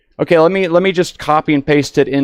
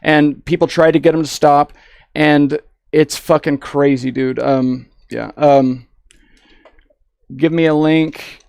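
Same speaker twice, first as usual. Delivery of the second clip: ordinary delivery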